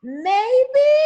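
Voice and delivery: high, slow, timid mock guess; unsure student. Teacher mimicking a hesitant student's answer, high and unsure. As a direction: mimic a student who isn't sure of her answer. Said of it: "Alicia's like".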